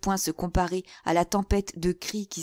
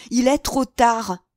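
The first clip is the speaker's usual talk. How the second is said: higher, loud and drawn-out despair; despairing cry; 6 semitones higher, 8 dB louder, slower than usual. A narrator's reading. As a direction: tear your hair out as you cry it. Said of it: "dit Mazarin en s'arrachant les cheveux".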